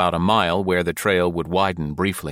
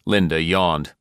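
plain reading voice

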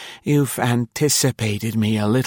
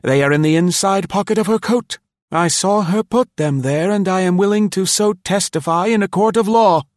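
loud, higher accusing exclaim; 11 semitones higher, 4 dB louder than usual. A narrator's reading. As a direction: denounce her loudly to the room. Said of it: "exclaimed loudly".

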